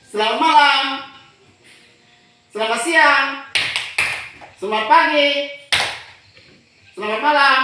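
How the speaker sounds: high, loud classroom cues; called greetings. Shown as a demonstration. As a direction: call each greeting out to the class. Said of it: "Coba ulang". (salam diserukan keras kepada kelas)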